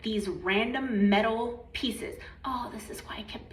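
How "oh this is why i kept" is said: hushed, breathy whisper; whispered aside. Real, not acted. Sudden realization, side remark.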